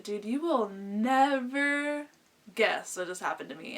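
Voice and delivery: drawn-out suspenseful build-up; dramatic news tease. Retold or imitated. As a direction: stretch out the start for suspense, then drop. Calls friend with big news.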